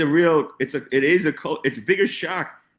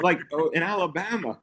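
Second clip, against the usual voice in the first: ordinary talk, nothing marked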